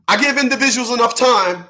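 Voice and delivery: usual speaking voice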